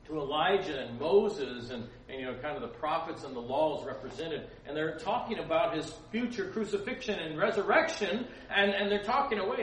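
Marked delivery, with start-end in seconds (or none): 4.7-9.6 higher and louder, laughing as it builds; excited laughing telling. Real, not acted. Preacher delighting in the Transfiguration, laughing and excited. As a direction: let the excitement spill over.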